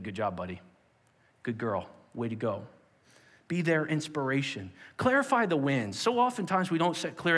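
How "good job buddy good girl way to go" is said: low, soft encouraging dad; gentle praise. Shown as a demonstration. Parent in the car after the game, gentle and low. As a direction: say it low and kindly. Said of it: "they just need".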